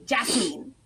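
"Jasmine" ending like "meen"; drawn-out long-e ending on "Jasmine"; acceptable variant. Shown as a demonstration. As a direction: say "Jasmine" slowly as jazz-MEEN. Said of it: "I totally get that".

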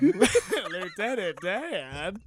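very drawn-out mock movie quote; Forrest Gump. Forrest calling to Lieutenant Dan, drawn-out imitation. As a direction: stretch the name out slowly, laughing.

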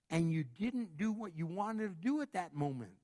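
usual speaking voice

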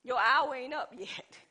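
usual speaking voice

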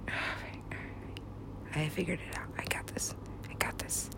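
barely audible whisper; very soft private self-reassurance; muttering to self. Real, not acted. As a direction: whisper it under the breath.

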